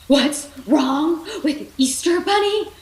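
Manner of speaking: high, loud, slowed frantic shout; panicked shout. Acted. Rex shouting back at Ham in panic, loud and high. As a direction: yell it back in disbelief. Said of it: "Rex shouted".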